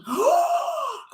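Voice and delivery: sharp high gasp of delight; excited gasp. Real, not acted. Telling about the game friends taught, excited gasp. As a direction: gasp as the idea hits.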